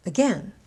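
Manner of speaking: "Again" as a-GAIN, second syllable stressed. Shown as a demonstration. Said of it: "I stressed both on the second syllable".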